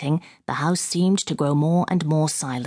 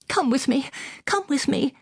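panicked urging; 10 semitones higher than usual. Acted. Panicked lady urges officer.

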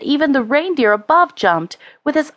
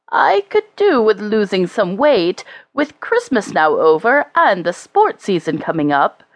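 plain reading voice

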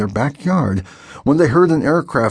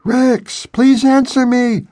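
much higher, urgent plea into the radio; pleading call; 13 semitones higher, 4 dB louder, slower than usual. A narrator's reading. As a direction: beg him to answer. Radio voice calling.

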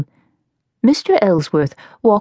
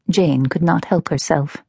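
ordinary delivery

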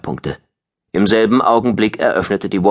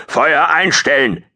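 slow, raised-pitch commanding call; booming command; 9 semitones higher, 3 dB louder, slower than usual. A narrator's reading. Colonel over the com to all ships, booming. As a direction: boom a drawn-out order. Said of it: "Ein Bass dröhnte".

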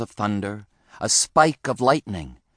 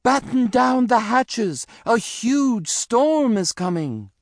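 high-pitched alarmed bunny; urgent cry; 12 semitones higher than usual. A narrator's reading. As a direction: cry the warning out, voice way up. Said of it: "Henry cried".

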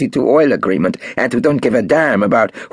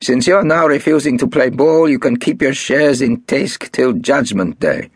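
higher, louder hard threat; Marcus, threatening; 10 semitones higher, 3 dB louder than usual. A narrator's reading. Marcus threatening Golda over the shares, hard and loud. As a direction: turn nasty, raise your voice.

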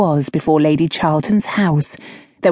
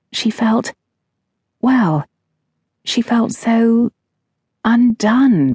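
higher, halting and unsure; flustered hesitation; 6 semitones higher, slower than usual. A narrator's reading. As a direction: stumble over the words, breathless.

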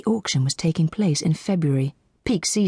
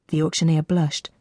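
usual speaking voice

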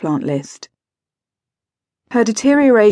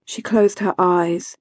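usual speaking voice

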